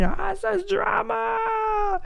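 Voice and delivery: high, slow exasperated yell; a mock cry of parental despair. Parent giving up on the dinosaur fight, exasperated cry.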